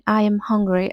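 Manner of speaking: ordinary delivery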